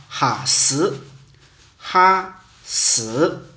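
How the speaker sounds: 用温州话把“哈死”说出来，“哈”重读; 温州话“哈死”，就是喝水; 温州话发音. Shown as a demonstration. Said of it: "这就是温州话". (温州话念“哈死”)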